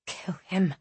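unvoiced whisper of the heart. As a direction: breathe it with no voice. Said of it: "screamed her heart".

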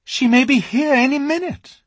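worried Susan; anxious Susan. A narrator's reading.

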